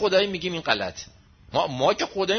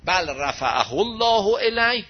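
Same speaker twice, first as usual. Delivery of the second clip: Quran recitation; 3 semitones higher, 4 dB louder, slower than usual. Acted. Verse recited.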